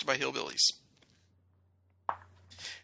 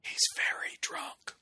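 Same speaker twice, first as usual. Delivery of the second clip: whispered aside; breathy whisper meant to be overheard; stage whisper; slower than usual. Acted. Aside about co-host being drunk.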